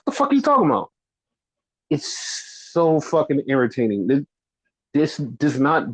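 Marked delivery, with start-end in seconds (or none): 0.1-0.9 angry rant. Real, not acted. Ranting at the company's excuse.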